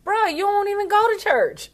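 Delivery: high, laughing mock disbelief. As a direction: tease a friend through laughter. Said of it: "And I'm like".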